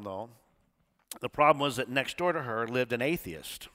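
ordinary talk, nothing marked

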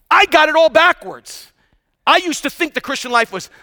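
high, punchy call to the congregation; rousing preaching. Real, not acted. Preacher quizzing the congregation on a verse, rousing preaching. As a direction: proclaim it and toss questions to the crowd.

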